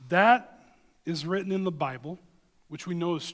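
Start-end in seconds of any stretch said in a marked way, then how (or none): 0.1-0.6 emphatic. Real, not acted. Emphatic point in sermon.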